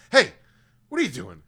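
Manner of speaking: sharp call-out to a misbehaving pet; retold scolding. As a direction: act out telling off a dog. Scolding the dog, acted out.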